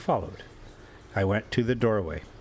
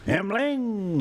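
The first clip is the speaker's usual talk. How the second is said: Emling called out, higher; drunken calling to his friend; 10 semitones higher than usual. A narrator's reading. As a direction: call across the moonlit beach. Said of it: "he cried".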